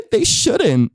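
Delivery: high-pitched, dainty shock; mock scandalized. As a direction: play gently scandalized with a little high gasp. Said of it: "we say something outrageous".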